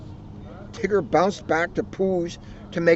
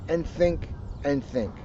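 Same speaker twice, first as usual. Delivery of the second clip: usual speaking voice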